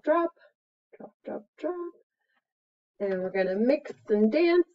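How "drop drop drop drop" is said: sing-song. Acted. Sing-song dropping dye.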